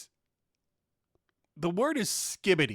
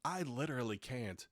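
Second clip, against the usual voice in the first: mock whiny teen; 6 semitones lower, 12 dB quieter than usual. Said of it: "Yes, you fucking can".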